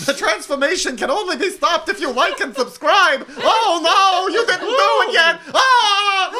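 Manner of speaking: high, loud, laughing clown shriek; loud slapstick yelps. Pretend. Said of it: "my clown voice".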